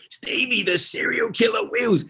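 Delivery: high, drawn-out mock announcement; announcing a nickname. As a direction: stretch out the nickname like an intro. Imitates co-host running with nickname.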